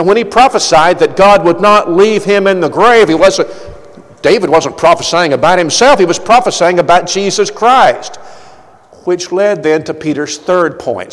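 usual speaking voice

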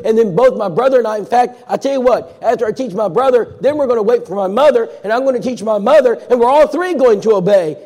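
high, loud and eager, rushing through her plan; a young woman bursting with her new idea; eager Callie. Retold or imitated. Callie telling Rob her family plan, high and eager.

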